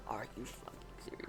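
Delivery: whispered outrage at the TV; incredulous whisper. Retold or imitated. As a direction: hiss it under your breath in disbelief. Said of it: "I'm like".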